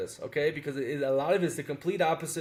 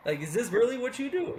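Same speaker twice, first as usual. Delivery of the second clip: much higher, disbelieving rebuke; incredulous anger; 7 semitones higher than usual. Retold or imitated. Expat planning to scold the late old tailor, higher and fed up. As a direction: confront him, unable to believe it. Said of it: "look at this old man and say".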